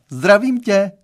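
high, loud greeting; calling out. A narrator's reading. Girlfriend's mother popping out to greet the boy, calling out. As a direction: call it brightly from behind a door. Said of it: "vyjukla zpoza dveří a zavolala".

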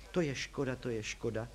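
soft, low lament; wistful. A narrator's reading. Young man mourns the photo without a jack, wistful. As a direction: drop the voice and regret it quietly. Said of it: "teskní Hypolit".

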